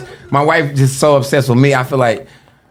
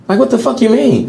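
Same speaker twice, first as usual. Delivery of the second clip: much higher, stunned question; incredulous outburst; 10 semitones higher than usual. Real, not acted. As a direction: throw the question back in real disbelief. Wife says not close enough.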